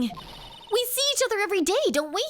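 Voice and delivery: put-on high, flustered young character voice; bewildered acted outburst; indignant sidekick. Sidekick protests to villain.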